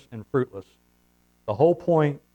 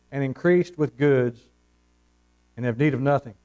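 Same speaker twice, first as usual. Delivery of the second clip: scripture reading. Real, not acted. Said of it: "reads scripture verse with pauses".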